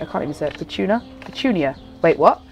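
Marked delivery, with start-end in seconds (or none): none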